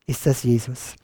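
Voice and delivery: usual speaking voice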